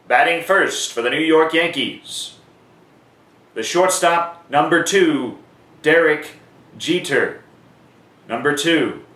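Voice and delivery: home batter called grandly, the number repeated at the end; PA announcer call. Shown as a demonstration. Announcer demonstrating the home lineup, in a grand stadium call. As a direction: stretch out Derek, drop onto Jeter. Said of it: "Notice how the number appears at the end for the home team".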